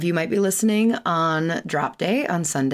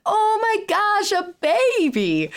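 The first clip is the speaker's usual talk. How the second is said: put-on high, slow, delighted squeal over a baby; gushing delight; much slower than usual. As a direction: gush like friends hearing baby news. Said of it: "They're like".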